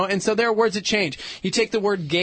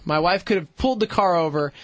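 ordinary delivery

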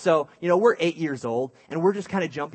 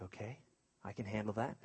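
very soft, lower reply; meek compliance; 4 semitones lower, 14 dB quieter than usual. Retold or imitated. Guilty kid answering mom, small and hushed. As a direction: imitate a cowed child agreeing quietly.

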